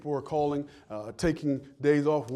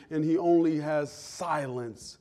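ordinary talk

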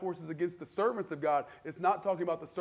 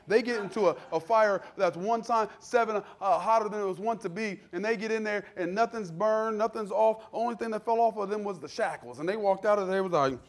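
raised, loud sermon storytelling; forceful preaching; 4 semitones higher, 6 dB louder than usual. Real, not acted. Preacher telling of the fiery furnace, forceful preaching. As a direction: preach the miracle with rising force.

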